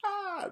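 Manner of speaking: a sudden high gushing squeal; high squeal of delight. Retold or imitated. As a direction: squeal like the game just melted you. Gushing over the game.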